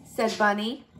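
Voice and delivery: ordinary delivery, nothing marked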